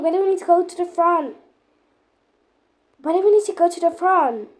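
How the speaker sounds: ordinary talk, nothing marked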